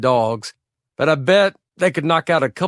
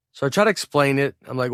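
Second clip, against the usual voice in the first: usual speaking voice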